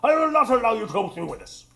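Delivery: loud, fast, indignant refusal. Acted. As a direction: put your foot down like a stern grown-up. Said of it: "I will not. You sound like a grown-up".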